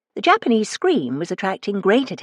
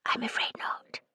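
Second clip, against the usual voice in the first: unvoiced, hushed whisper; 13 dB quieter than usual. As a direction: breathe it so nobody overhears. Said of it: "she whispered".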